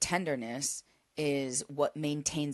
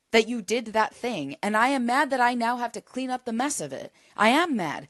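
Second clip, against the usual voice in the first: firm declaration; 6 semitones higher, 5 dB louder than usual. Shown as a demonstration. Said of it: "you have to be able to say".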